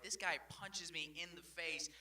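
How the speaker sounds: ordinary talk, nothing marked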